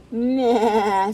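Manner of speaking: long loud bleat; imitated goat's maa, drawn out. A narrator's reading. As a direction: bleat like a goat. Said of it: "said Goat".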